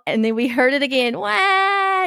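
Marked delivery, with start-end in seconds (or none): 1.2-2.0 mock wail, much higher; imitated louder wail. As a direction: do the kid's cry, louder and higher. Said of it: "It got louder".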